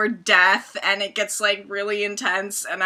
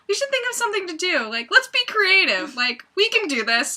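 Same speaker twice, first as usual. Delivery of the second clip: mock eager planning; 8 semitones higher than usual.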